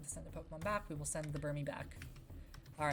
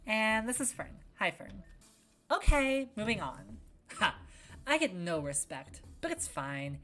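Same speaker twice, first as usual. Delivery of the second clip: bright-spirited, raised voice making introductions; lively character voice, pushed up and louder on key words; excitable game character; 4 semitones higher, 4 dB louder than usual. Acted. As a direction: introduce friends with peppy, high-energy cheer.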